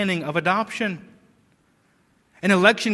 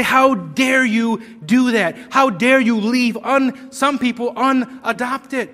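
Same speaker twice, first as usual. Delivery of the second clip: loud, higher-pitched outraged challenge; mock indignation; 4 semitones higher, 5 dB louder than usual. Preacher voicing people who accuse God, mock-indignant. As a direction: act out an indignant protest at full voice. Acted protest against God's choice.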